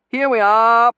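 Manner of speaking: loud, high, merry call; calling out gaily. A narrator's reading. Terence gaily greeting the boatman from the bank, loud. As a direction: sing out cheerfully to the boatman. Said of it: "Terence called out gaily".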